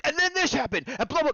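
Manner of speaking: high-pitched excited blurting; re-enacted breathless-news excitement; excited retelling. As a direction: burst out with the big news. Retells their own excited outburst.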